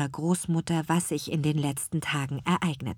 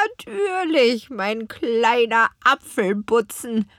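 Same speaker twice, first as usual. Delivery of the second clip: much higher, slow, croaking crone voice; croaking old witch; 6 semitones higher, 5 dB louder, slower than usual. A narrator's reading. Grandmother witch promises her granddaughter, croaking. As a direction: croak it fondly like an old hag. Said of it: "krächzte ihre Großmutter".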